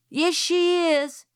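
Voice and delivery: put-on high, slow, loud child's shout. A narrator's reading. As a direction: drag it out, yelling to be heard. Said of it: "shouted Francis".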